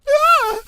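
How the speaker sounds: sharp, very high loud shriek; startled shriek. Acted. Fluttershy leaps up in fright, high shriek. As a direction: yelp in fright.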